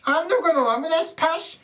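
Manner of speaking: put-on high, piping little girl voice; a small defiant Scottish girl speaking up; wee girl voice. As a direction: play a tiny indignant girl. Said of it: "a wee tiny girl says".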